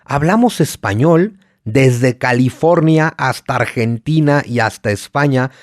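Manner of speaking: ordinary talk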